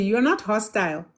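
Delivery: normal talking voice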